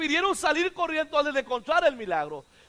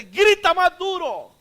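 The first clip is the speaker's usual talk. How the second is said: loud, high, slow exhortation; urging shout; 5 semitones higher, 8 dB louder, slower than usual. Real, not acted. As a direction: cry out the command to the congregation. Preacher urging listeners to shout.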